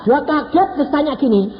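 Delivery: ordinary delivery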